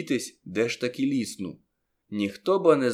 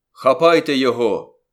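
loud, urgent command; shouted order; 8 dB louder than usual. A narrator's reading. George ordering men to seize the conjurer, shouted order. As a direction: shout it to set men on him. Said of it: "крикнув Джордж".

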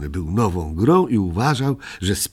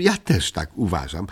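ordinary delivery

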